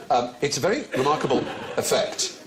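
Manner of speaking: normal talking voice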